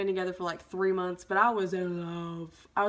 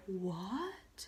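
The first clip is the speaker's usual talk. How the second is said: mock stunned disbelief; 3 semitones lower, 13 dB quieter than usual. Retold stunned reply to cheating claim.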